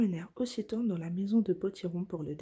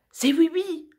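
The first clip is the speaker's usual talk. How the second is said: much higher and louder, crying out in alarm; 9 semitones higher, 9 dB louder than usual. Acted. Finot spotting Oui-Oui's taxi, alarmed cry. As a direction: shout the warning in a panic. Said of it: "s'écria Finot".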